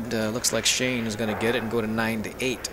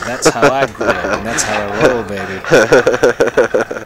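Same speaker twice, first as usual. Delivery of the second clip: loud boast; 11 dB louder, slower than usual. Real, not acted. Hyped boast about cartoons.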